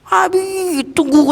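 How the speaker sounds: put-on high, called-out plea of a toddler; imitating a two-year-old crying out for dad; child's mock yell. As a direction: yell after daddy not to leave. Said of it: "teriak dia".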